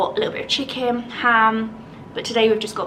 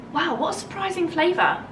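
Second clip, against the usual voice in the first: surprised delight; 8 semitones higher than usual. Real, not acted. Tasting hearts of palm.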